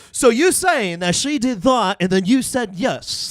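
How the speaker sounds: slow, loud, incredulous mock of the host calling him out. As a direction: drag it out like catching a liar. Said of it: "He's like".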